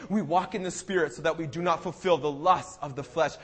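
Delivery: usual speaking voice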